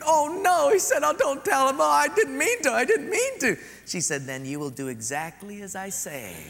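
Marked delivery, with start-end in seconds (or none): 0.0-3.6 put-on high, loud pleading child; begging boy. Boy begs sister. 4.2-6.4 put-on softer, smug bossy girl; smug sister. Sister blackmails brother, smug and soft.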